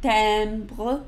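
Timbre with nasal E, tam-bruh; French timbre. Shown as a demonstration. Teacher modeling Timbre, nasal E. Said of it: "Say it with me".

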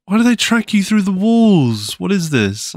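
exasperated panic; shrill protest at the game; indignant yelp. Real, not acted. Panicked at mobs.

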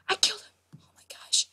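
whispered amazement at a kill; stunned whisper. Real, not acted. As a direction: whisper it, barely believing it. Astonished at own kill.

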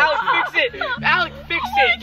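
high, frantic plea; panicked. Real, not acted. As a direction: cry out in sudden panic. Panicked demand at Ouija board.

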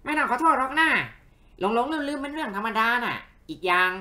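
high, loud character voice; forgiving little companion. Acted. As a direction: pipe up high, drawing out the reassurance.

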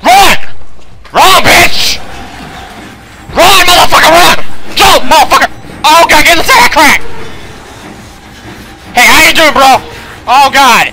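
high, full-volume frantic shouting; panicked yelling. Real, not acted. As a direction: scream at someone to run for their life. Fleeing enemy in horror game.

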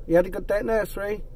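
usual speaking voice

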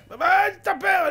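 high, loud, drawn-out objection; indignant protest. Acted. Haman balking at giving a haircut, high indignant protest. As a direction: balk loudly at the demand. Haman balks at cutting hair.

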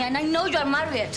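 high, forceful charge; heated accusation. Acted. Woman telling Marcus she knows of his marriage, heated and high.

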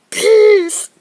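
very loud one-word sign-off; shouted goodbye. Real, not acted. Yelling goodbye to viewers, very loud.

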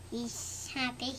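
plain reading voice

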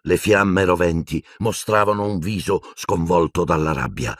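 ordinary delivery

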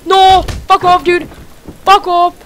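loud, high angry yell; furious shout. Real, not acted. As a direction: bark it at the attacker. Enemy attacking him.

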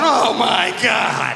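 long, high drawn-out cry; horrified mock wail. Dad dodging three hours of dog mess, slow horrified wail. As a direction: drag it out slowly in disgusted horror.

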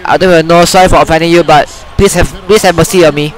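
normal talking voice

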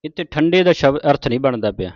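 ordinary talk, nothing marked

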